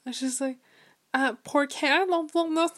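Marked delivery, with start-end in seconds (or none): 1.1-2.8 higher mock-child Spanish; imitated little cousin. Little cousin on the phone pressing her question, imitated. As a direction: mimic the cousin's pestering question.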